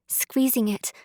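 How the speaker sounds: ordinary delivery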